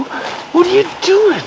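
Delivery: very high, drawn-out baffled question to the dog; squeaky pet voice. Real, not acted. Owner baffled by dog Monty fighting the towel, very high. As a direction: squeal at the dog in mock disbelief.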